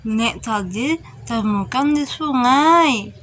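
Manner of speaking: put-on old woman's voice; old grandmother. Grandmother says she found the scarf, elderly voice. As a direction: answer as a granny, rising on the first words.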